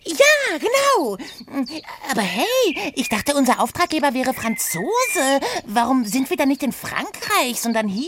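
hohe, neugierige Figurenstimme; Oda, verspielt. Acted. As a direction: quirlig und naschhaft nachfragen. Oda voice continues. (hohe Stimme, schwärmerisch gedehnt)

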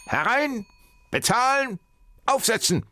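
high, clipped barked commands; brisk professor voice. A narrator's reading. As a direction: bark orders like a hurried professor. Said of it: "sagte dieser".

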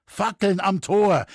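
high, loud, slow call; drawn-out watchman's call. A narrator's reading. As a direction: call it down from the tower, drawing out the words. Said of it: "dumpfe Worte schallten langsam in gezogenen Tönen". (langsam gezogener Ruf des Türmers)

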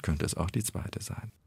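ordinary talk

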